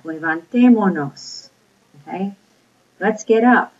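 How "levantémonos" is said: LEVANTÉMONOS slowly, going up on TÉ; stress on accented syllable. Shown as a demonstration. Teacher drilling the accent mark, stressed TÉ in levantémonos. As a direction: lift and punch the TÉ in "levantémonos". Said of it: "Go up on the accent mark".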